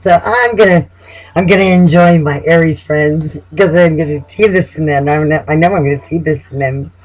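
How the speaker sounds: usual speaking voice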